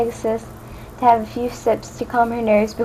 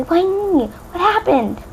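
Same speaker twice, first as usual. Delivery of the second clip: higher, anxious questions; Fluttershy asking Twilight, frantic and higher; 7 semitones higher, slower than usual. A narrator's reading. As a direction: plead for answers. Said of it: "asked frantically".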